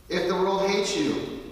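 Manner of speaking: loud, slow reading of the verse; 1 emphatic scripture reading. Shown as a demonstration. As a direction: proclaim it to the congregation. Reads the scripture aloud.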